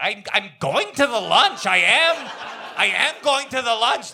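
hammed-up insisting on going to the lunch; mock emphatic quote. Host teasing the guest by repeating his words, hammed-up insistence. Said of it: "It was like".

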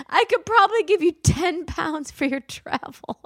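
mock-entitled bride voice, pitched high and whiny; hammed-up demanding bride reading her messages. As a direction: read the bride's texts like a spoiled princess. Reading the bride's text aloud.

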